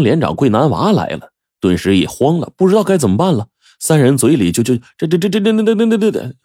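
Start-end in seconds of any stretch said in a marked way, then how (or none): 4.6-6.3 stuck on the same word, rising high; stammering. A narrator's reading. Terrified family stammering before the militia chief. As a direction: stutter in panic, words won't come. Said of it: "磕巴起来了".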